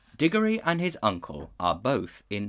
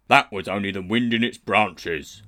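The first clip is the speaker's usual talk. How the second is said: warthog; 7 dB louder than usual. A narrator's reading. Warthog declaring the fainted man a tree. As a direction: grunt it like a stolid warthog.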